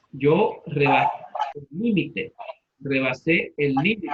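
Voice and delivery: "límite" said LÍ-mi-te, stress on LÍ; stress on the first syllable. Shown as a demonstration. Teacher using the word in a sentence, "límite" stressed on LÍ. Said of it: "Como esdrújula, límite".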